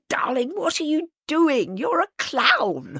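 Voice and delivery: high-pitched, slowing amused reproach; high, drawn-out mock dismay; Joan Littlewood. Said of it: "And she said".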